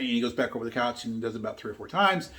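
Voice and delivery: ordinary talk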